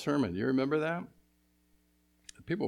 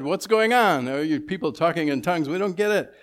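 voicing the confused crowd, pitched higher and louder; bewildered crowd; 6 semitones higher, 7 dB louder than usual. Preacher voicing the Pentecost crowd, baffled and high. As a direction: ask it like baffled onlookers. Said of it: "People said".

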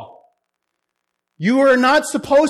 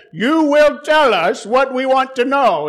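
put-on voice of a pompous interrogator; demanding chief priest; 4 semitones higher, 4 dB louder than usual. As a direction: demand it as a high priest, higher-pitched. Chief priest interrogating Jesus, acted.

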